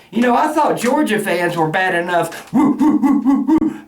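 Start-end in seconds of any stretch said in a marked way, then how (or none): 2.5-3.8 a hint of a fan barking "woo, woo"; mocking fan barking. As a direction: lightly bark like a rowdy Georgia fan. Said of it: "barking in people's faces".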